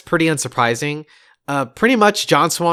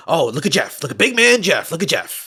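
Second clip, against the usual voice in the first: jeering mock admiration, pitch jumping on "big man"; mock him with overdone admiration; mocking praise; 2 semitones higher than usual. Co-host imitating streamers who mock Jeff on Instagram, mocking. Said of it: "mock him by saying".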